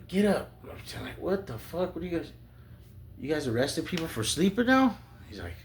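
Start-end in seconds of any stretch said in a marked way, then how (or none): 0.1-0.5 loud, high command; barked order. Retold or imitated. Cop orders him up. 1.2-5.0 high, incredulous protest; indignant protest. Retold or imitated. Indignant reply to cop.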